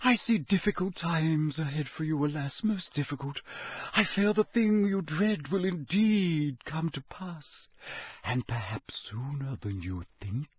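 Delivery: prophesy doom sorrowfully; mournful seer. A narrator's reading. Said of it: "Her voice dropped almost to a whisper".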